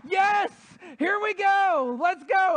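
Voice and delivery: high, loud gleeful yell; excited yell. Retold or imitated. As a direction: shout it like you just grabbed the ball. Player thinks he scores.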